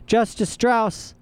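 a voice calling a name from offstage. A narrator's reading. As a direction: call her name from afar. A voice calls from offstage.

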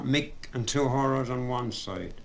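usual speaking voice

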